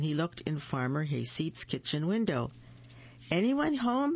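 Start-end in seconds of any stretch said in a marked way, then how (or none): 3.3-4.1 small animal voice, pitched up high; a curious little raccoon asking. Acted. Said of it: "he asked".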